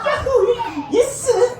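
high, gleeful whooping; elated cheer. Acted. Younger son setting off with his money, elated cheer. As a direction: celebrate in a high excited voice. 신나가지고 가고 있어.

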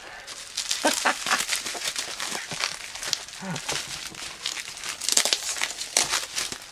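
soft giddy laugh; breathy delighted laugh. Real, not acted. Collector laughing with delight at the figure. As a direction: laugh under your breath with glee.

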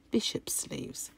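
soft whisper under the breath. Real, not acted. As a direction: whisper it to yourself. Repeats name under breath.

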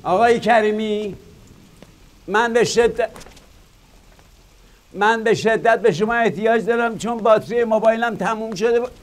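high urgent call, fast. Acted. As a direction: call across the place to find him. Calls out for help.

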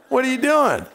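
high, mock-exasperated question; exasperated scolding. Jesus teasing the preacher about running off, mock-exasperated. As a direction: play Jesus fed up with you.

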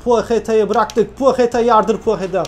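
rising shouts urging the winger on; excited cheering. Real, not acted. Gamer yelling at his winger to run.

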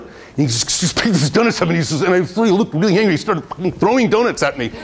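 usual speaking voice

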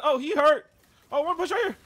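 high, loud frantic calls; excited shouting. Real, not acted. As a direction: shout urgently to teammate. Tense fight in game.

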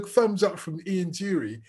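normal talking voice

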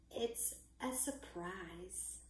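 very slow, soft near-whisper; soft storybook hush, slowly; hushed secret. A narrator's reading. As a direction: draw out the secret under your breath. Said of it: "whispered Mouse".

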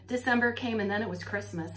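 ordinary delivery

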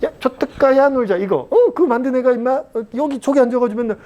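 high-pitched, gushing mock boast; acted bragging. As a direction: boast excitedly about a famous former student. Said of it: "내가 이제 자랑하는 거지".

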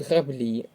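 граблі stressed on final ЛІ; stress on second syllable. Shown as a demonstration. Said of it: "наголос на другий склад".

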